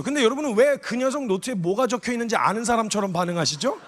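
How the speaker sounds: ordinary talk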